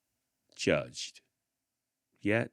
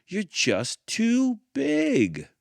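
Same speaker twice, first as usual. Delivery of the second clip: much higher, louder, slow, dismissive; blunt dance instructor; 12 dB louder, much slower than usual. A narrator's reading. Dance instructor tells the girl she is too big, high and loud. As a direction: drag it out like a careless teacher. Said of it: "said the dance instructor".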